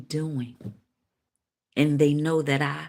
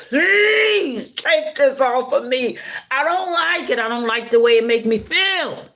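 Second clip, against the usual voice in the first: desperate plea; 9 semitones higher, 4 dB louder than usual. Retold or imitated. Woman crying out to Alba to take it away, desperate plea. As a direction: stretch out PLEASE and beg with full voice. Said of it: "In the way that I said".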